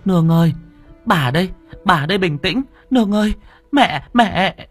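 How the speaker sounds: high, loud frantic calling; panicked. A narrator's reading. Bà Hỏa soothes the delirious girl, panicked. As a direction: call out urgently, trying to calm her through panic. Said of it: "Bà Hỏa hốt hoảng".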